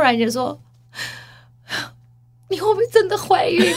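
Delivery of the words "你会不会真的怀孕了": high-pitched, about-to-cry question; mimicked tearful father. Dad at the red light asks his daughter, mock tearful. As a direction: ask as if tears are coming. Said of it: "然后他就哭".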